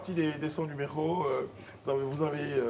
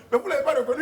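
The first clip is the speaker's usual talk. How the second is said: laughing; 13 semitones higher, 5 dB louder, faster than usual. Retold or imitated. Policeman quoted, laughing.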